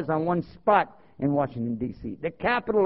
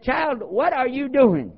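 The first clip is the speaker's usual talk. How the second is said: lightly voiced elder lady, higher and slow; slow, raised-pitch scolding question; imitated elder lady; 7 semitones higher, 4 dB louder, slower than usual. Elder lady questioning the convert, imitated in a higher voice. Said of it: "the lady, one of the elders there".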